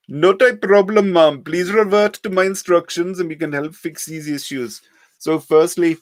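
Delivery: put-on high, loud scam-caller accent; heavy Indian accent. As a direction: play a pushy call-centre scammer. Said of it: "heavily accented voice".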